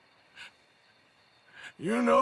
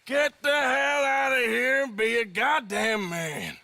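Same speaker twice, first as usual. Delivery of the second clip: angry shout; 8 semitones higher than usual. Acted. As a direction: bark the order to go, no arguing. Ordering brother to leave.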